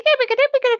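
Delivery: high sung ding ding ding; high bell-like dings; mock ding bell. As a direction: chime it like a game show bell. Imitating TV bell sound.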